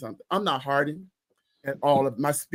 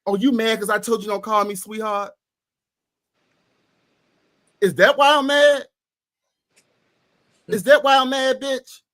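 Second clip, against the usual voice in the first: heated argument; 4 semitones higher than usual. Retold or imitated. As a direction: tell her off with rising heat. Heated retold phone argument.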